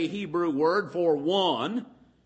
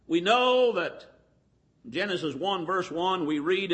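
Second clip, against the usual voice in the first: ordinary talk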